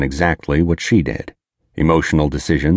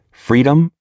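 freedom lifted high and louder; emphatic freedom; 11 semitones higher, 4 dB louder than usual. A narrator's reading. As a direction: hit the word freedom hard and high. Take my word on this.

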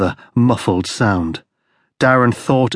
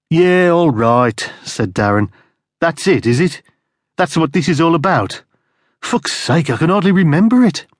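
exasperated; 6 semitones higher than usual. Acted. Darren exasperated, dismissive.

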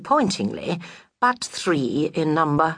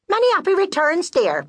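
much higher, fast, chirpy gossip; fussy friend voice; 13 semitones higher than usual. Acted. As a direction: trill it like a busybody friend.

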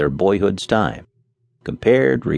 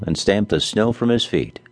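ordinary delivery

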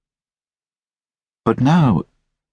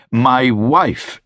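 higher-pitched exclamation of introduction; proud cry; 7 semitones higher than usual. A narrator's reading. Gentleman presenting his wife to Mister Hackett, crying out. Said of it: "cried the gentleman".

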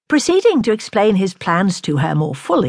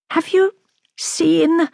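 high, hesitant entreaty; imploring; 9 semitones higher, slower than usual. A narrator's reading. Lady begging news of his errand, imploring. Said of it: "she began imploringly".

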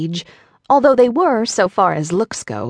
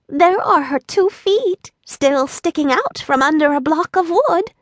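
put-on high, light little old woman voice; a lightly voiced tiny old lady; sweet old woman; 8 semitones higher than usual. A narrator's reading. Old woman explaining to Dorothy, light and high. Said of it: "she continued".

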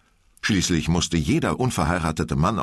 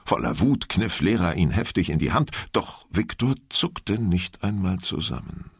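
ordinary delivery, nothing marked

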